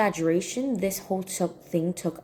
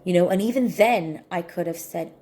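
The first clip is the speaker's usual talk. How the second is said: ordinary talk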